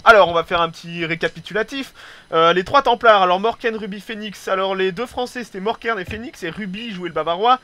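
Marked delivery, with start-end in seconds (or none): none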